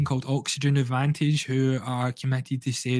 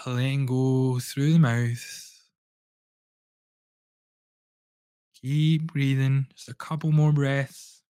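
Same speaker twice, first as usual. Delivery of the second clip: guided breathwork. Acted. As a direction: lead the listener gently through each breath. Leads a guided breathing session.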